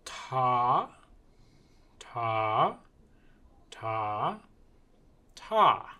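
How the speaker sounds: slow chant of "Ta", long gaps between; drawn-out, evenly spaced "Ta" syllables. Shown as a demonstration. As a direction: hold each "Ta" and leave space before the next. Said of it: "I can go slower".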